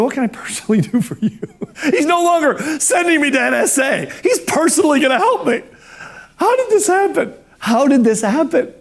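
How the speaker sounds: high, laughing, giddy disbelief. Real, not acted. Speaker retelling his lucky phone call, laughing in giddy disbelief. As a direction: giggle it out, can't believe your luck.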